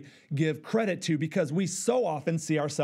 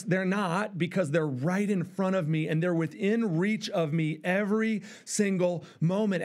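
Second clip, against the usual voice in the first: ordinary talk, nothing marked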